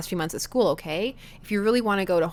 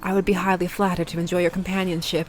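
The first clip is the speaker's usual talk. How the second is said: formal faux-British gentleman voice; fake British accent. [name] inviting the dad to afternoon tea, fake British accent. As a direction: put on a courtly British accent. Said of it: "my atrocious fake faux British accent".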